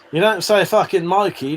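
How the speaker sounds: ordinary delivery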